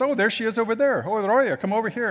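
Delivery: high, lively imitation of a friend calling out; calling someone over. Woman spots someone in her small group, calling her over.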